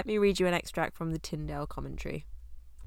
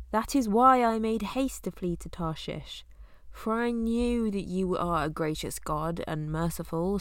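raised, louder Bible reading voice; a louder reading of Jonah's lament; scripture reading; 4 dB louder than usual. Acted. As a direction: read the prayer out with weight to the congregation. Reads Jonah's prayer from Bible.

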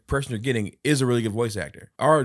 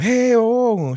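high, loud startled oh oh oh; startled yelps; 14 semitones higher, 10 dB louder than usual. Retold or imitated. Uncle reenacting his own alarm at the door, loud and high. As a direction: yelp in alarm.

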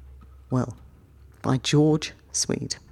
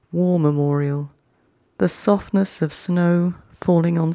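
slow, measured haiku reading with long pauses; unhurried radio poem reading; poetry reading. Acted. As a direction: let each image settle before the next. Reads haiku aloud, each twice.

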